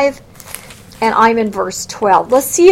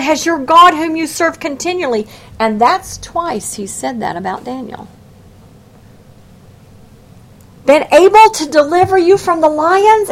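lamenting cry; 6 semitones higher, 3 dB louder than usual. Retold or imitated. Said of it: "moans out of the depths".

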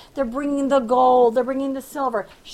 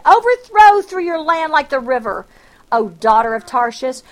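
forceful scripture reading; 8 dB louder than usual. Acted. Preacher proclaiming Isaiah's oracle on Egypt to the congregation, forceful reading. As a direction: proclaim the verse, a touch louder.

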